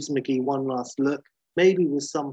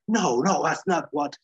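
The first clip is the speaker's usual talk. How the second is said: higher, louder cut-in protest; 3 semitones higher, 4 dB louder than usual. Acted. Banner protesting McGee's accusation, agitated. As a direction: object quickly, raised voice.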